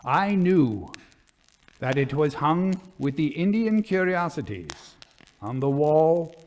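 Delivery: dramatic audiobook narration, building dread toward the end; story narration. A narrator's reading. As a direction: read it like a ghost story to listeners. Reads the ghost story aloud.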